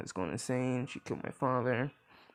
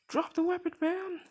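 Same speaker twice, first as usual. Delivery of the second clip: much higher and louder, a shouted order; police command; 5 dB louder than usual. Retold or imitated. Police officer shouts an order at the armed mother. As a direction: bark it like a cop at gunpoint. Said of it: "one of the police officers says".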